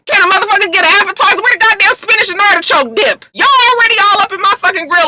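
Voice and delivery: high, fast, yelling complaint; fed-up shout. Pretend. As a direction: blow up at the nosy friends. Speaker plays grilled Cisco, defensive.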